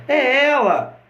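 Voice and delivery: "é ela" agudo e exclamado, em surpresa; exclamação surpresa. Shown as a demonstration. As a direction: exclame "é ela" como quem se espanta. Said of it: "as entonações vão marcar as frases". ("é ela!" exclamado, agudo, com surpresa)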